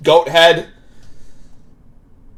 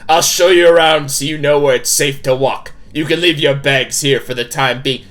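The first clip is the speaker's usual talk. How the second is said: put-on game character voice, stern hostess; firm, projected character voice; Tabitha; 3 dB louder than usual. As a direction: play a bossy hostess laying down house rules. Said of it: "I gotta do my Tabitha voice".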